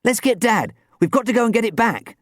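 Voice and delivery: high, hurried plea; rally someone urgently; urgent cry. A narrator's reading. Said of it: "Dan cried".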